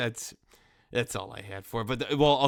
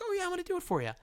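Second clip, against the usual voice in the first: high, light imitation of the friendly barber; much faster than usual. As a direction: squeak it out like a cheerful hairdresser. Voicing the barber.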